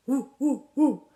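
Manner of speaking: short imitated monkey calls; playful "hoo-hoo" monkey noise; monkey hoots. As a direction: hoot back like a monkey. Monkeys copy the son.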